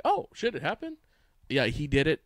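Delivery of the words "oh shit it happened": much higher mock "oh shit" of disbelief; hammed-up surprise from a guilty poster; fake shock. Host mimics an online commenter pretending to be shocked by the news. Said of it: "then he's like".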